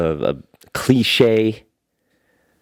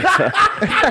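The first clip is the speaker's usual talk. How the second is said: shrill burst of laughter; high laugh. Real, not acted.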